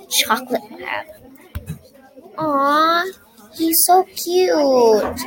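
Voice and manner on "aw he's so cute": slow, high, melting over a puppy; adoring aw. A narrator's reading. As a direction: coo at the cute dog slowly. Child sees the puppy.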